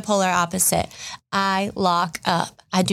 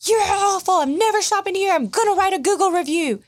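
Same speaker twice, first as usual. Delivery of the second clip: mock angry customer; 12 semitones higher than usual. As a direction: ham up the furious shopper. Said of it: "someone come in angry, cursing".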